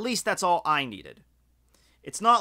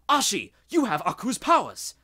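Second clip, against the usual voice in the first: higher, excited realization; mock surprised Jack; 4 semitones higher, 3 dB louder than usual. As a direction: gasp it out as a discovery. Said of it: "Jack realizes".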